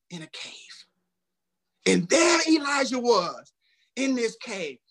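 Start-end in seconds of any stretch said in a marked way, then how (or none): 1.9-4.8 forceful preacher's call, loud; preaching. Acted. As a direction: proclaim it to the congregation.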